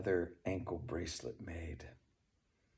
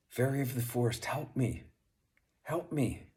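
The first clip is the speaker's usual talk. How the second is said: higher, louder and faster, pleading for help; urgent calling out; 6 semitones higher, 4 dB louder than usual. Acted. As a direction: cry out to the fairy, building urgency. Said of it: "he called out".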